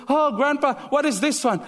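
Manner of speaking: put-on high, eager child voice asking grandpa; curious grandchild. Grandchild asking grandpa about old photos, high and eager. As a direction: play the curious kid, pitched well up.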